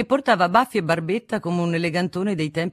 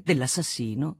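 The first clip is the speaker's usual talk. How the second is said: usual speaking voice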